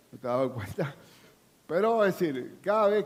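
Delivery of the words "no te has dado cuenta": teasing the congregation with a chuckle; laughing repeat. Shown as a demonstration. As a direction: laugh it out under your breath. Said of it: "Tú no te has dado cuenta".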